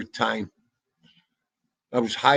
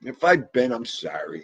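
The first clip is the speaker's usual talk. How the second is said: ordinary talk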